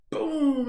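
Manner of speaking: high, loud "boom" of a blowout; sudden high-pitched bang noise; imitated boom. Telling friends how the car dropped, loud imitated boom.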